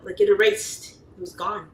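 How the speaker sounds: normal talking voice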